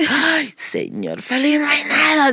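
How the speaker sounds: high, plaintive little complaint; retold high-pitched lament; imitated dismayed child. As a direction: whine it like a disappointed child. Acts out child's dismay at empty table.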